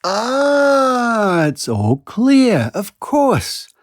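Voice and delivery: high, drawn-out mock "ah" of understanding; sarcastic realization. Real, not acted. Skeptic host mocking the alien excuse, sarcastic. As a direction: pretend it all makes sense now, with irony.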